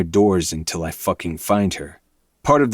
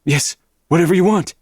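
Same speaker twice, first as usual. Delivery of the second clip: panicked pleading; 11 semitones higher, 5 dB louder, faster than usual. A narrator's reading. Victim begging, high pitched.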